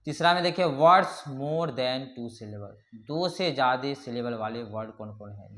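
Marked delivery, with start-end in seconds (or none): none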